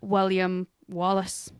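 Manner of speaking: slow, rolled Scottish William Wallace; Scottish accent. Shown as a demonstration. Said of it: "Say it in a Scottish accent".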